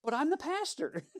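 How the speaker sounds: squeaky indignant pastor voice; mock indignant pastor. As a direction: play the baffled young pastor, half laughing. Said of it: "he was like".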